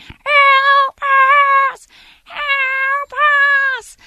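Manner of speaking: very high, loud, drawn-out screech; urgent screech. Retold or imitated. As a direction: screech for help, long and piercing. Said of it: "louder and more urgent and more screechy".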